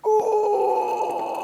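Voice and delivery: high squealing ooh; a hammed-up squeal of shock; cartoon squeal as heads explode; mock ooh. Vinyl crowd's head explodes.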